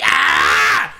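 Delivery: loud cry of disgust; mock disgusted holler; exasperated yell. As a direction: cut off with a loud fed-up "ah". Breaks off bird voice in disgust.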